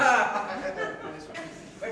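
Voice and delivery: loud hearty laugh; burst of laughter. Acted. Navvy laughing at the punchline, hearty laugh. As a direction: roar at the punchline.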